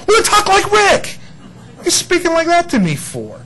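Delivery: God telling him to just talk normal; mock exasperated God. God scolding Rick for stilted prayer, high and exasperated. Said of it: "And God's going".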